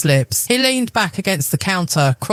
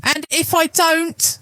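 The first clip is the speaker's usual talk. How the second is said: challenging; 11 semitones higher, slower than usual. A narrator's reading. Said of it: "she challenged".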